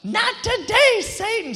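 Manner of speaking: high, loud, hammed-up defiance at the devil; imitating Morgan. Pastor tells the crowd how to greet Morgan, high and loud. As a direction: sing out "Not today" way up high. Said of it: "just tell her".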